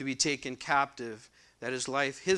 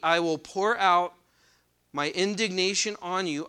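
raised, louder reading voice from the pulpit; scripture reading; 4 semitones higher, 5 dB louder than usual. Acted. Preacher reading Ezekiel aloud to the congregation, proclaiming. Reads verse thirty aloud.

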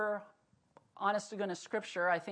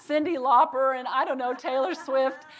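laughing; 6 semitones higher, 11 dB louder than usual. Real, not acted. Laughing at the songs in her ear.

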